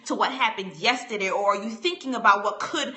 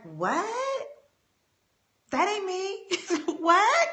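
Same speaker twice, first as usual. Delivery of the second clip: mock incredulous; 8 semitones higher, much slower than usual. Mock outrage at own breathlessness.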